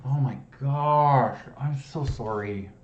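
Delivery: high, loud, slow dismay; mortified exclamation. Real, not acted. Solver aghast at an obvious miss, mortified. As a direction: draw it out in disbelief.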